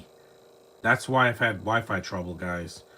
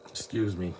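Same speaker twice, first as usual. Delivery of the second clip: ordinary talk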